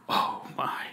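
breathless little whisper; scared whisper. Retold or imitated. Boy caught by grandma, whispering in dread. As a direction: hush it like a frightened kid.

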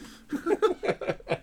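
high-pitched laughter. Real, not acted. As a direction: burst out laughing. Laughing at the joke.

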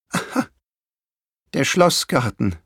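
mild acted sigh of recognition; understated storybook sigh. A narrator's reading. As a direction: let it out with a touch of weary resignation. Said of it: "seufzte sie".

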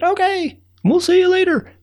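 very high squeaky little ant voice; high ant voice calling back cheerfully; tiny ant voice. Acted. Tiny ants answering the narrator.

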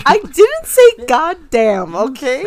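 high, loud, slow laughing protest; indignant denial. Real, not acted. Girlfriend denies his quote, loud laughing indignation. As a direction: drag it out in playful outrage.

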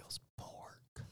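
slow confessing whisper. Real, not acted. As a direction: whisper it slowly, guiltily. Hushed answer confessing the meat.

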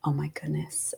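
breathless hushed whisper; awed whisper. Retold or imitated. Mother offered to feel baby's head, awed whisper. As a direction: barely breathe it in wonder. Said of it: "And I was like".

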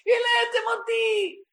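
high, slow, loud exhausted cry; the Shechina crying out at her children. Acted. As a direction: scream it as the worn-out Divine Presence. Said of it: "היא צועקת".

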